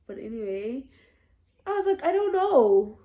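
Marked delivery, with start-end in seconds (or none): 2.0-3.0 high, loud exasperated protest; acted-out helpless outburst; flustered retort. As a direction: throw it back loudly, pitched right up. Acting out her flustered answer.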